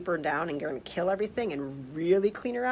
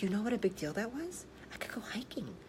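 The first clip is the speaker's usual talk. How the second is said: whisper; 2 semitones higher, 9 dB quieter than usual. Retold or imitated. Patient confides about going hiking.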